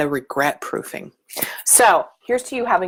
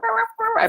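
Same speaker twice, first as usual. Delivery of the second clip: much higher mock wailing sobs, half-hearted; mock crying; much faster than usual. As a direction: imitate a silly blubbering cry. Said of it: "I share transparently crying".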